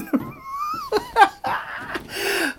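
very high shrieking laugh; squealing laugh. Real, not acted. Hit by her pillow, a high squealing laugh.

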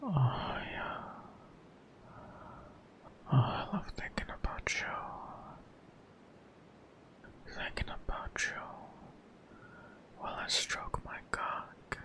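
soft, close sensual whisper; breathy intimate whisper. Acted. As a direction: whisper it like a secret to a lover. Intimate whispered audio for partner.

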